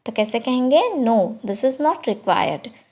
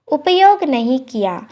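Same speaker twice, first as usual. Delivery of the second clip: usual speaking voice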